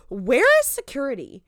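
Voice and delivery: high, loud exclamation of disbelief; incredulous alarm. Retold or imitated. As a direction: call out in mock alarm at the lapse. Recounts own alarmed reaction to show.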